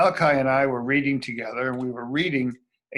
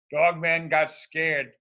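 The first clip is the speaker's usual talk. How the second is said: ordinary delivery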